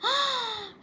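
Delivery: high acted gasp; frightened gasp. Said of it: "She was scared".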